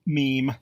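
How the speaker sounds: meme as one syllable, "meem"; proper pronunciation. Shown as a demonstration. Narrator introducing the next word, meme pronounced "meem". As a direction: say meme to rhyme with team. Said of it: "Proper pronunciation is meme".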